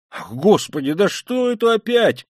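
alarmed exclamation rising high; startled, high-pitched alarm; frightened cry. A narrator's reading. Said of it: "вскрикнул, по-видимому, в совершенном испуге".

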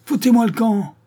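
high, loud yell through a door; shouting back. A narrator's reading. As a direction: daughter screaming get out. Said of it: "rugissait Hortense à travers la porte". (hurlé à travers la porte, aigu et fort)

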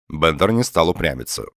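ordinary delivery, nothing marked

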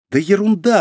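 high-pitched breezy dismissal; dismissive. A narrator's reading. Mizuki waving away the money worry, dismissive. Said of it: "отмахнулась Мизуки".

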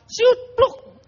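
high, sharp whistled slip then a plop; slip-and-plop sound effect. Retold or imitated. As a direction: mimic the cockroach sliding and falling. Cockroach slipping sound effect.

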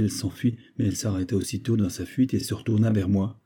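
usual speaking voice

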